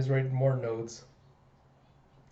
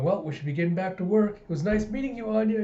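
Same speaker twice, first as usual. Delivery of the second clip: higher, lilting farewell; sweet goodbye; 6 semitones higher than usual. Acted. Doctors leave to work, sweetly waving goodbye. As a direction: bid a small child a sing-song goodbye.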